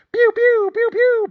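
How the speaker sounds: high, loud playground gun sounds; pew pew gun noises. Pretend. Host imitating a sugared kid gaming, high loud pew noises. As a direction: blast like a kid on Call of Duty. Kid playing shooter game.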